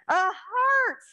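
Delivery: bright and lifted; excited. Real, not acted. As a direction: cheer the answer. Excited child answer confirmed.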